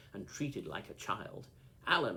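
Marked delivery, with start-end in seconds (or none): 1.9-2.2 short loud exasperated call; crying out. A narrator's reading. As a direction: snap his name out. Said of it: "I cried".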